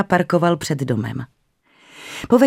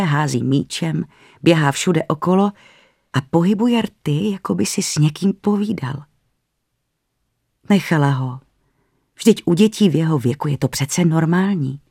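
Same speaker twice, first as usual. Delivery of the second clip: ordinary delivery, nothing marked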